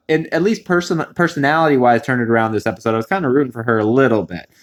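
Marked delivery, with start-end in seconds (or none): none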